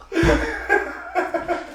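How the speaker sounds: high burst of laughter. Real, not acted. Reacts to movie scene.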